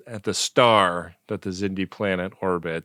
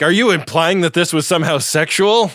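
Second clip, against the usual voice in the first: high, pointed mock indignation; indignant mock question; 7 semitones higher, 4 dB louder than usual. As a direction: play it as a scandalized question. Mocking T'Pol's indignant question.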